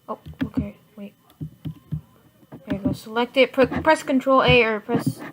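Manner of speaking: normal talking voice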